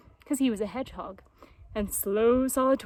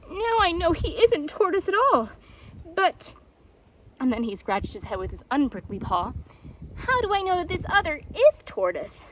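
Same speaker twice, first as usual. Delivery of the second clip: Painted Jaguar; 6 semitones higher than usual. A narrator's reading. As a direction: work it out through the sting. Jaguar in pain, puzzled.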